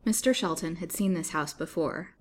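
plain reading voice

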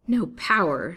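loud, flashing challenge; indignant repeat. A narrator's reading. Captive repeats villain's boast, indignant. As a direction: throw his words back at him. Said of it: "gazing at him with flashing eyes".

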